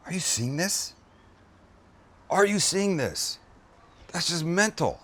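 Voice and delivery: astonished at the cheese pull; amazed. Real, not acted. Amazed at the food.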